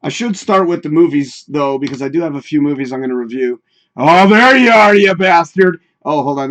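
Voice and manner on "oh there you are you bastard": gleeful shout. Real, not acted. Finds the banner he's hunting for.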